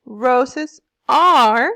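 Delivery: "Roses are" with loud swells on the stresses; volume up and down. Shown as a demonstration. Teacher showing meter to class, "Roses are" heavily stressed. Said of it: "volume in my voice goes up and down".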